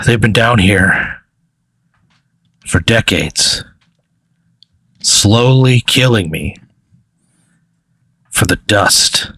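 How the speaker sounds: slow, halting weary captive; very tired creature. Acted. Blue furry prisoner answers the player, worn out and slow. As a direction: draw it out wearily, with long pauses. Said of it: "He looks very tired".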